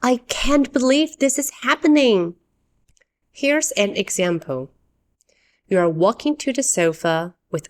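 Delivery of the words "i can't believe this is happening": higher, emphatic disbelief; strong disbelief. Shown as a demonstration. English teacher explains a strong complaint, in emphatic disbelief. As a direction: exclaim it like you can't believe it. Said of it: "This is a strong way to say".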